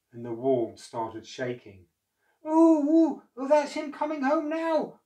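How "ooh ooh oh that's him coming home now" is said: high, loud fearful cry; enormous lady crying out in fear; frightened cry. Acted. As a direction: panic as the floor shakes. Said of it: "cried the lady".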